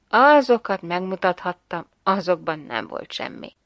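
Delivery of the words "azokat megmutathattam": ordinary delivery, nothing marked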